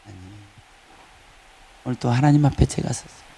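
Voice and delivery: low, unhurried talk with God; conversational prayer. Acted. Preacher modelling prayer as communion with God, low conversational prayer. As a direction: confide in God, pitch dropped low. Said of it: "이게 기도라는 거예요".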